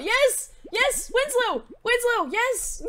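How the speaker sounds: short high-pitched cheers; elated cheering. Real, not acted. Player finding Winslow, elated high cheers. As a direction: squeal each word in triumph. Worm found, celebrates.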